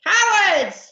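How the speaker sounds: high, loud pirate howl. Acted. Captain to the escaping ship, howling. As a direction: howl it after the fleeing enemy. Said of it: "howled Captain Abdul".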